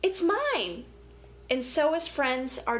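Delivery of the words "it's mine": lightly voiced bully. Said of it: "and says".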